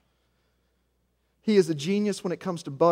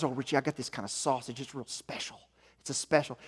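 excited dad; 2 semitones lower, 6 dB quieter than usual. Retold or imitated. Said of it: "He'll text me about it with a sparkle in his eyes".

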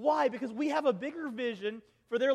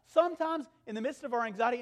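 ordinary talk

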